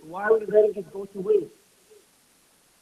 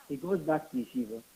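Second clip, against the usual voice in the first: normal talking voice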